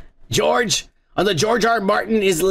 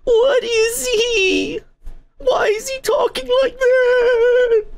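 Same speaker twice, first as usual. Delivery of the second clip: mocking whiny critic; slower than usual. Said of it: "are all like".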